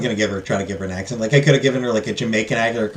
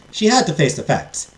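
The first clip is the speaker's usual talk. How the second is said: plain reading voice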